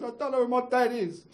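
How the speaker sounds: high, loud imitation of an upset boy; put-on tearful whine of a young boy; mock tearful child. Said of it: "he got all tearful".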